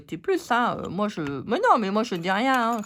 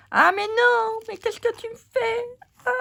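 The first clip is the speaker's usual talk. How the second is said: high, loud cry of dismay; shocked exclamation; dismayed outcry; 12 semitones higher, 4 dB louder than usual. Real, not acted.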